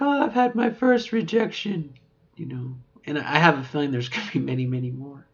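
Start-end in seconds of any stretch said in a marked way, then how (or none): 0.0-2.0 mock chirpy. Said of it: "It's like".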